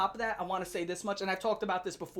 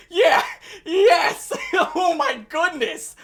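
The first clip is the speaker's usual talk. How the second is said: laughing; 9 semitones higher, 11 dB louder, much slower than usual. Real, not acted. Laughing reaction to video.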